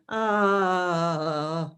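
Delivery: long "ah" sliding steadily down in pitch; descending "ah" exercise. Shown as a demonstration. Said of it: "and then I'll start down there".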